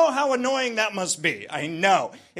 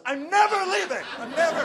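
much higher, delighted vow; hammed-up glee; gleeful declaration; 7 semitones higher than usual. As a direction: vow it like a thrilled kid.

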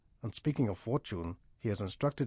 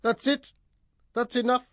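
much higher and louder, at the end of his tether; exasperated outburst; 13 semitones higher, 7 dB louder than usual. Acted. Scammer caller finally snaps at the chatty host, exasperated shout. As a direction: snap and give up on the call.

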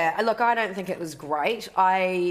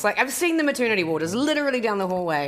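normal talking voice

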